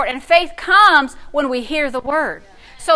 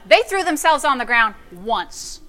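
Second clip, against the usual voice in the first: ordinary talk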